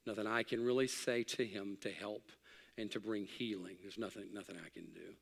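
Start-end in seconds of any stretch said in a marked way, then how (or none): 3.8-5.1 much softer, nearly breaking off; hushed sorrow. Real, not acted. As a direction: let it fall away in helplessness. Grief, voice drops away.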